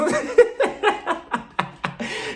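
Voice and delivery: a breathy, high burst of laughter; unvoiced high cackle; high airy laugh. Real, not acted. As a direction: laugh off the cheeky toast. Laughing at own toast.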